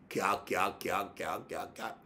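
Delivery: fading imitation laugh; mock chuckling. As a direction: imitate a mocking cackle quietly. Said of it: "Élie rigolait".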